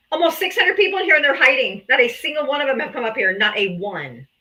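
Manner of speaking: ordinary delivery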